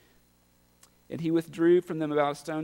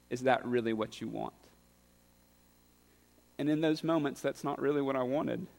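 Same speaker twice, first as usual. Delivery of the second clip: ordinary delivery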